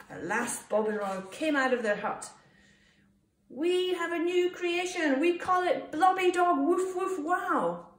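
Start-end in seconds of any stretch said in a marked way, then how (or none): 3.5-7.9 high, loud, proud reveal; triumphant announcement. A narrator's reading. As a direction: proclaim it to the crowd. Proud reveal of joint creation.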